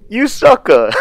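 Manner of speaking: high, loud playful jab; laughing taunt. Real, not acted. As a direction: tease him through a laugh. Teasing buddy after shark reveal.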